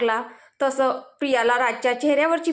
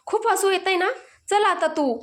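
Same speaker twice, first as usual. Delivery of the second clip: a touch of irritation, pitched up; mildly annoyed; 6 semitones higher than usual. A narrator's reading. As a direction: snap back at her, understated. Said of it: "चिडून म्हटलं".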